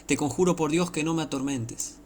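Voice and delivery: high, pleading cry read aloud; acted outcry of the possessed man. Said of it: "clamando a gran voz dijo".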